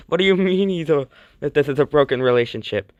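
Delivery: acted indignant challenge, rushed; indignant protest. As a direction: fire back in mock outrage. Indignant reply acted once more.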